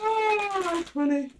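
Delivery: high, laughing exclamation; real amused laugh through the words; real laughter. Real, not acted. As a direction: still laughing as she speaks. Laughing at her own reading.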